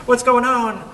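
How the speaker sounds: high, loud alarmed question; imitating frightened neighbors. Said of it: "All the neighbors are like".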